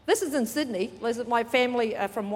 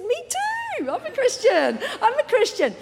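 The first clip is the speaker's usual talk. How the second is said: very high, loud, laughing excitement; overjoyed exclaiming; 12 semitones higher, 5 dB louder than usual. Retold or imitated. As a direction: act out giddy delight, nearly squealing. Acts out overjoyed shared discovery.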